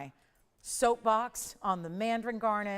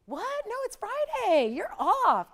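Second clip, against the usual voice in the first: very high, incredulous friend's protest; mock dismayed protest; 12 semitones higher, 6 dB louder, slower than usual. Host acts out best friend hearing of Friday work, high incredulous protest. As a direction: squeal it like a disbelieving friend, getting louder.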